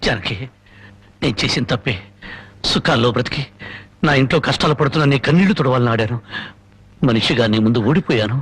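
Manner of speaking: low, defeated admission of guilt; low confession. Acted. Husband admitting defeat to his wife, low and defeated.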